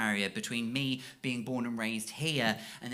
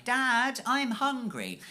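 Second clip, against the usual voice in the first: lightly voiced kid; 8 semitones higher, much slower than usual.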